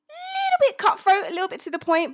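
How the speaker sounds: very high, loud squeak; coy understatement. Real, not acted. Admitting her character's sharp side, coy high squeal.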